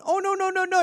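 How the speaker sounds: high cooing apology; apologetic owner. Retold or imitated. Owner soothing the cat, high apologetic coo. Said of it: "the second you start going".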